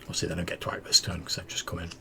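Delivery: fast rules whisper; whispered aside. Real, not acted.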